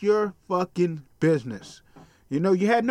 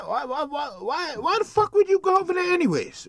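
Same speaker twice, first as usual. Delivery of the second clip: exasperated why; 8 semitones higher than usual. Real, not acted. Exasperated rant at the victim.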